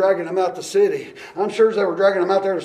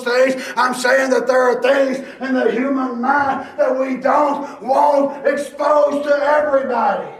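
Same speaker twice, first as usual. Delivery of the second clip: high, loud pulpit shouting, slowing at the end; full-force revival preaching; fiery preaching; 6 semitones higher, 7 dB louder than usual. Acted. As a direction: preach it out to the whole congregation.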